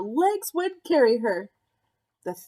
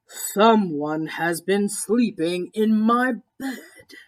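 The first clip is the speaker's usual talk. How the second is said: slow, big Papa Bear voice, louder on chair; great big voice; 2 semitones lower, 3 dB louder, slower than usual. A narrator's reading. Papa Bear finds his chair used, big slow voice. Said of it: "said in his great big voice".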